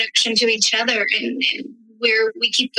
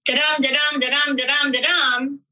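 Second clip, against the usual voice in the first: da-DUM, da-DUM, a steady drum beat on each second syllable; retold mentor's da-dum demo; iambic da-dum rhythm; 2 semitones higher than usual. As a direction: beat out the meter for the listener. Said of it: "there's a couple ways to look at iambic pentameter".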